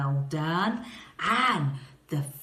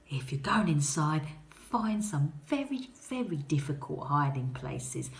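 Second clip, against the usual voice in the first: hushed tip; 6 semitones lower, 6 dB quieter than usual. Acted. Teasing quiet suggestion.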